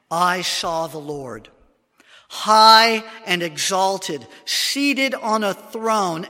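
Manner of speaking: slow, swelling reading of the vision; solemn scripture recital, slow and grand; dramatic scripture reading. Acted. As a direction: read it like the throne room is before you. Reads Isaiah's vision aloud.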